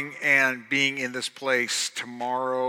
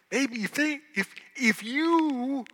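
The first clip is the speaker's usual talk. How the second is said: high, loud preacher's challenge; fiery preaching; 13 semitones higher, 10 dB louder than usual. Acted. Pastor challenges the congregation to feel the song, preaching hard.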